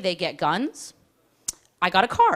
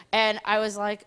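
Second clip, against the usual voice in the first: normal talking voice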